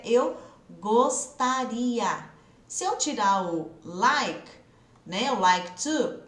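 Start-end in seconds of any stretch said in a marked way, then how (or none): 0.0-2.2 "gostaria" drawn out very slowly; stress on gostaria. Shown as a demonstration. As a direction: stretch "gostaria" slowly for emphasis. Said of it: "Porque agora é".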